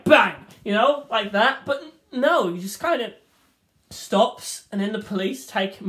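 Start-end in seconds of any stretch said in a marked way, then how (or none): 0.0-0.4 loud spoken bang; mock gunshot. Villain firing one last shot, loud spoken bang. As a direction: punch out a gunshot noise.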